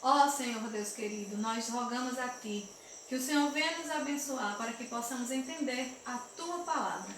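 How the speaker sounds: soft hushed prayer voice. Acted. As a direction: pray gently for the children. Leads a prayer with the children. (oração suave e reverente)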